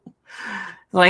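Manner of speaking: usual speaking voice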